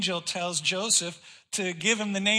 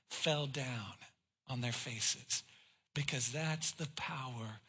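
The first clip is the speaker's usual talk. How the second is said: much lower and softer, awed; hushed preaching; 6 semitones lower, 7 dB quieter than usual. Acted. Preacher telling how the soldiers fell down, hushed. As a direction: let it sink to a hush.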